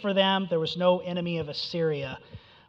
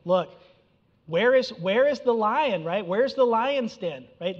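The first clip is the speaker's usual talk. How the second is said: mild mocking taunt; 5 semitones higher, 5 dB louder than usual. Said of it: "the idea here is he's saying".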